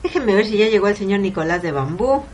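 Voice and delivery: ordinary delivery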